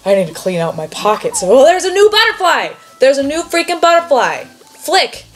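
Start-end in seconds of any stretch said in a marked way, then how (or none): 1.4-5.2 higher, louder cry at a new find; excited discovery. Real, not acted. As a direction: blurt it out, thrilled. Player spots a new butterfly.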